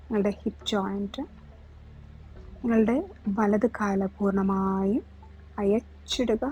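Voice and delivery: slow yoga nidra voice, drawn-out words and long pauses; guided relaxation. Real, not acted. Yoga teacher talking listeners through relaxing each body part, guided meditation. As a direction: lead the body to let go, unhurried, phrase by phrase.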